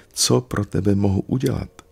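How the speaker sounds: eager question. A narrator's reading. Little soul eagerly asks again.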